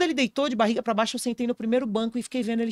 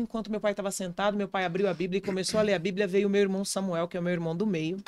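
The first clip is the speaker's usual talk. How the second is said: ordinary talk, nothing marked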